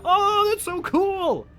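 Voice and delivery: drawn-out, high, loud delight; high excited exclamation; thrilled. Real, not acted. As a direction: gush slowly and loudly, really impressed. Thrilled viewer is from Russia.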